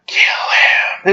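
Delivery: conspiratorial whispered aside; hushed, sneaky whisper. Retold or imitated. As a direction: whisper a secret instruction. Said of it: "he's like whispering to me".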